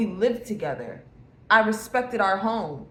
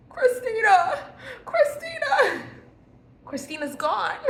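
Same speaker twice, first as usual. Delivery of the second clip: mock dramatic; 13 semitones higher, slower than usual. Declaring old self gone.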